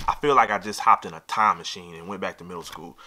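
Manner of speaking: ordinary talk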